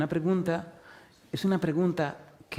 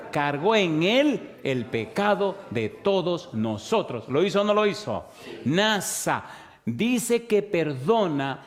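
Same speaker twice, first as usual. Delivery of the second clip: preaching at full force; 3 semitones higher than usual. Acted. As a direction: drive each yes-or-no question home to the flock. Preacher drills the congregation.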